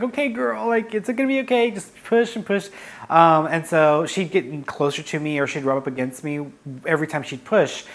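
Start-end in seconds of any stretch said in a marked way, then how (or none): 0.0-2.7 higher, coaxing encouragement; encouraging coaxing. Real, not acted. Owner coaxing the pushing cat, higher encouraging voice. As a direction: cheer her on gently through each push. Coaching the cat through labor.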